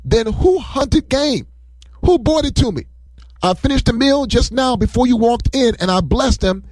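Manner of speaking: put-on high, slow, shaken old father; stunned disbelief, voice pitched high; trembling shock. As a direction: gasp it out as a father realising he's been tricked. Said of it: "started trembling and shaking violently".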